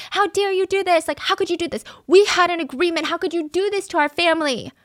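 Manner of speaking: high, pleading outrage at the neighbors; imitating a wife screaming at cheaters; mock outraged yell. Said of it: "she would scream at them".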